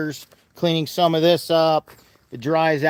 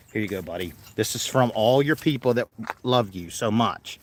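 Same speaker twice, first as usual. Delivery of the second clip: soft pet talk; 6 semitones lower, 5 dB quieter than usual. Real, not acted. Feeding the animal a treat.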